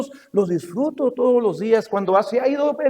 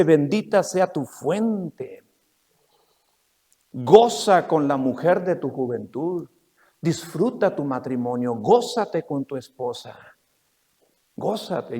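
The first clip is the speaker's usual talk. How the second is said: slow, low and softer reading of the verse; scripture reading; 7 semitones lower, 5 dB quieter, slower than usual. Acted. Preacher reading Proverbs to the congregation, slow and soft. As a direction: savour each word of the verse.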